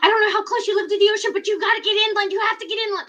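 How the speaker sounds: high, loud frantic warning; aunt calling in a panic about the tsunami; panicked aunt. Retold or imitated. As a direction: imitate an aunt in a panic, rushing. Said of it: "And she's like".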